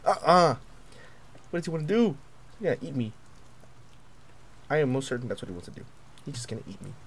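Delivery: usual speaking voice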